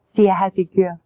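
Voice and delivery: ordinary talk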